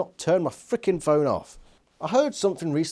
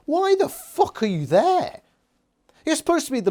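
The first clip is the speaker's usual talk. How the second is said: exasperated disbelief; 12 semitones higher, 4 dB louder than usual. Real, not acted. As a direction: pitch it way up in disbelief. Ranting at Visser three.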